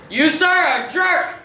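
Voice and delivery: high mock-indignant outburst. Captain insulting the crewman, hammed-up indignant outburst. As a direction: hurl the insult in hammed-up outrage. Acted insult in improv scene.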